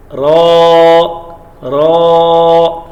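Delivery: ra said loud and full, no rolled r; clear ra letter. Shown as a demonstration. Teacher models the letter را for students. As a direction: sound out را crisply without trilling the r. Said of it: "را، اب یہ را پہ تکرار نہیں کرنا".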